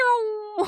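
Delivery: high imitated cartoon zoom; cartoon getaway noise. Teller imitates the waiter's escape, cartoon getaway noise. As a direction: make a cartoon scurry-and-zoom sound effect. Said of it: "if he was a cartoon".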